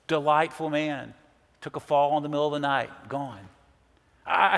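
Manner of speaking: ordinary talk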